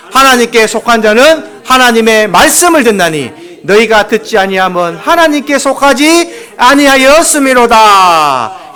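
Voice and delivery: loud, slow, solemn verse reading; scripture reading. Acted. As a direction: proclaim the verse slowly and loudly. Reads verse 47 aloud. (크고 느리게 성경 구절을 선포하듯 낭독)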